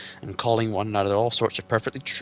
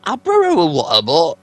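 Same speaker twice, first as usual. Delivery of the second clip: much higher, louder puzzled troll voice; startled trolls; 7 semitones higher, 13 dB louder than usual. Acted. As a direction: blurt it like a baffled troll.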